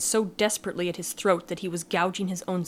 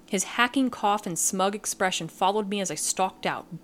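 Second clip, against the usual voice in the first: ordinary delivery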